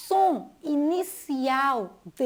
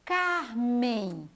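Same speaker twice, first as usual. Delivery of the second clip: drawn-out name. Shown as a demonstration. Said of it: "E aqui".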